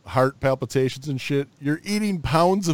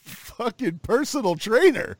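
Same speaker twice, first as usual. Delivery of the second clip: much higher, exasperated; incredulous; 10 semitones higher than usual. Real, not acted. Friend mocking a personal trainer's bacon logic, incredulous. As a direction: pitch shoots up in disbelief at a friend.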